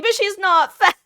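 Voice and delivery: very high, loud mock protest; joking indignant defense. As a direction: blurt it in hammed-up outrage. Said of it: "I joke with the way".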